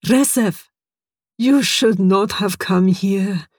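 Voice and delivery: put-on slow, heavy-hearted old colleague; sad warning. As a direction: regretfully tell him he shouldn't be here. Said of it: "Horst answered sadly".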